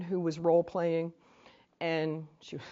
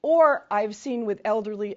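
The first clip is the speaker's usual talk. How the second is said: ordinary delivery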